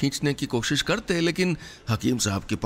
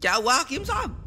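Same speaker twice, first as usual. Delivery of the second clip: much higher, startled ask; alarmed question; 14 semitones higher than usual. Acted. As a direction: rush in worried. Compounder startled asks.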